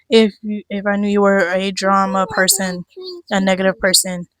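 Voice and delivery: ordinary talk, nothing marked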